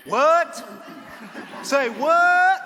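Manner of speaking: can't believe what she just heard; real surprise. Real, not acted.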